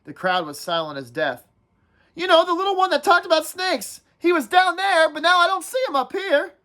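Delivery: whiny schoolboy nagging the group, pitched well up; Piggy's voice. Acted. Piggy voiced in read-aloud.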